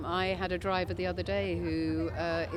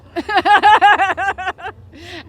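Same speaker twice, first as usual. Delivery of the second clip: loud laugh; 10 semitones higher, 18 dB louder than usual. Real, not acted. Laughing at her own joke.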